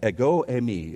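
"Ego eimi" pronounced EH-go AY-mee; Greek pronunciation. Shown as a demonstration. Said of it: "Everybody say it".

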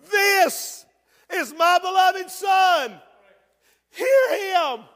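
high, loud, slow proclamation; booming voice of God. Acted. Preacher proclaiming God's words to the church, booming and high. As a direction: thunder it out like God from the cloud.

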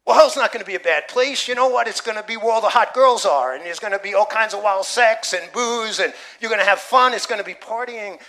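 mock the skeptic who wants the party; a preacher voicing a carefree skeptic; mocking scoffer. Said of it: "and they're like".

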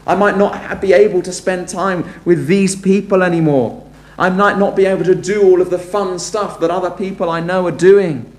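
lightly voiced worry about giving up fun; worried would-be believer. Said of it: "maybe are worried about the consequences".

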